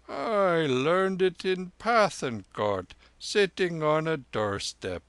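high, slow lama voice, mildly shy. Acted. Lama confessing to the soldier where he learned it, mildly shy. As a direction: answer with a touch of shyness. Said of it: "said the lama shyly".

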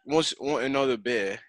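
Irish attempt landing as English; failed Irish accent. Shown as a demonstration. Host tries an example, Irish accent turns English. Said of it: "that's completely English".

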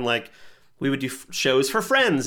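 ordinary delivery

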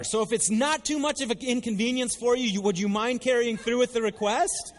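loud, high-pitched sarcastic challenge; incredulous, pushy mother talking back; exasperated woman. Acted. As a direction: demand the favour with biting mock politeness. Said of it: "a little bit of my paraphrase".

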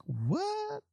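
sudden high-pitched disbelief; shocked what. Retold or imitated. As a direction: squeak it out in pure shock. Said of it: "I was like".

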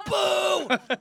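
high, loud mock booing; imitated crowd boo. Crowd rejecting the opening act, imitated booing. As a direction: boo like a whole hostile audience. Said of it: "And they go".

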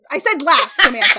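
loud, laughing exasperation; mock scolding. Real, not acted. Host to co-host who jumped ahead, loud mock scolding.

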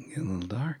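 soft, playful copy of an ominous line; sultry imitation. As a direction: say it hushed, teasingly dark. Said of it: "it's kinda sexier that way".

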